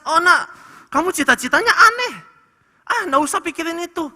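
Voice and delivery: lightly acted judging parent; dismissive parent. Parent dismissing child's odd dream.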